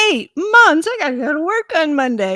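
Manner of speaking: high, loud shocked echo; exasperated retold protest; incredulous outburst. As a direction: blurt it back in disbelief. She echoes doctor in shock.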